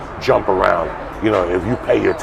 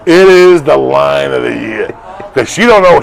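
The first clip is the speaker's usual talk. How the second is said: loud, high shout, first line stretched out slow; excited raving; 5 semitones higher, 7 dB louder than usual. Real, not acted. Fan raving about a favourite movie line, excited shout. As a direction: proclaim it at full volume, relishing every word.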